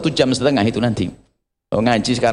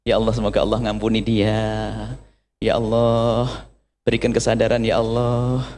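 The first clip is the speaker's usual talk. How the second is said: a meek wife softly praying for her wrongdoer; forgiving prayer; 5 semitones lower, 4 dB quieter than usual. Retold or imitated. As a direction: gently pray for the one who hurt her.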